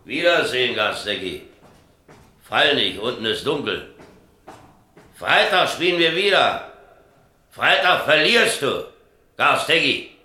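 loud, high calls down into the dark; shouting a farewell from above; calling out. Acted. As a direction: call after him, loud and high. Calling down to falling man.

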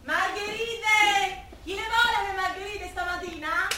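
long drawn-out vendor cry; stretching "Margherite!" out very slowly; street vendor call. Acted. Flower seller calling out wares.

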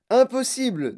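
loud, high exclaimed refusal; refus net. A narrator's reading. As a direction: dismiss it emphatically. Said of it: "dit monsieur Wonka". (refus lancé d'une voix forte et aiguë)

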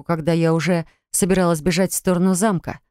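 usual speaking voice

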